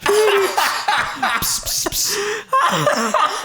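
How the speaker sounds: very high coaxing cat call with pss sounds; laughing while calling the cat, high and drawn out. Retold or imitated. Searching Newcastle for the lost cat, high coaxing call.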